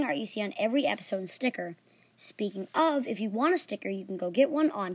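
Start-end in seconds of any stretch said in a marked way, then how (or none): none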